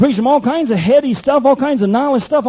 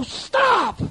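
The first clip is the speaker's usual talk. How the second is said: shout; 11 semitones higher, 4 dB quieter than usual. Retold or imitated. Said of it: "You go".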